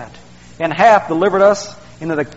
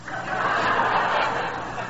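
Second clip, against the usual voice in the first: soft breathy laugh; 10 dB quieter than usual. Real, not acted. Pastor laughs at own joke.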